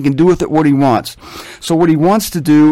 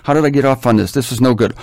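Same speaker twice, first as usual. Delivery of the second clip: ordinary talk, nothing marked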